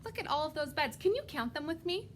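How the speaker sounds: plain reading voice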